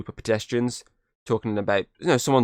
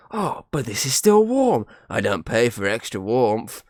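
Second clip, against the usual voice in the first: greedy dealer voice; 2 semitones higher, 4 dB louder, slower than usual. Retold or imitated.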